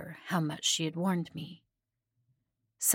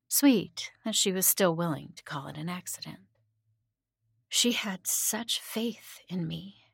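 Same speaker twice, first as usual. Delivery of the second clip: ordinary delivery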